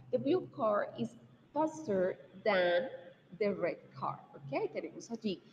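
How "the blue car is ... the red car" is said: "faster than" drawn out in the middle; patient teacher reading; slow model sentence. Shown as a demonstration. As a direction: fill in the blank slowly. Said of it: "le agregaríamos la E R".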